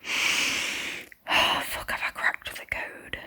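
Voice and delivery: breathy whisper under a sigh, almost silent; awed whisper at a realisation; hushed whisper. Real, not acted. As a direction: whisper it to yourself in disbelief. Hushed self-talk while reading.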